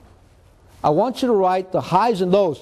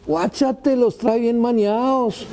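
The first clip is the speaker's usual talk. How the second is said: high-pitched heckling classmate; lightly mocked classmate; 5 semitones higher, slower than usual. Classmate jeers at his torn pants, high mocking voice. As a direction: tease in a raised, drawn-out voice.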